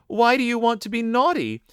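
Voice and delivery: high, puzzled motherly question; astonished. A narrator's reading. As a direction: ask high, in disbelief. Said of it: "in astonishment".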